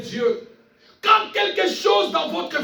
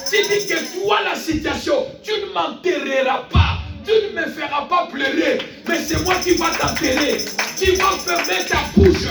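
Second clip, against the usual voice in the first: raised, relentless preacher's shout; forceful declaration; 3 semitones higher than usual. Real, not acted. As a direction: command the trouble to stop, at full force. Commands the situation in declaration. (proclamation criée avec autorité)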